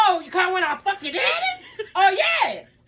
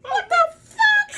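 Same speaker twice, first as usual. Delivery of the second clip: high, drawn-out cry of disbelief; shocked outburst; 12 semitones higher, much slower than usual. Real, not acted. Mother hearing the prostitute claim, shocked. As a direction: wail it slowly, way up high.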